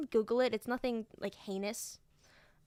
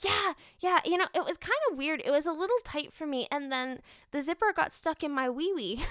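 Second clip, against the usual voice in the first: lightly voiced chatty little boy, higher and louder; kid voice; 5 semitones higher, 4 dB louder than usual. Teacher lightly imitating the second grader about his costume. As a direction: tell it like an earnest seven-year-old.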